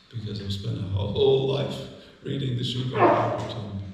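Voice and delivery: mock tearful plea. Thief in tears answers Yamaraja.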